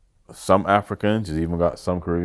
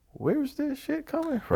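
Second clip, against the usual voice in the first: incredulous; 9 dB quieter than usual. Retold or imitated. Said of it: "And I was like".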